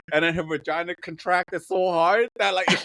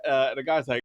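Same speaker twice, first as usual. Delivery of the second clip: ordinary delivery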